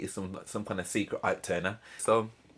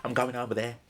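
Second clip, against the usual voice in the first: mock sass; 3 semitones higher, 6 dB louder than usual. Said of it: "to be like".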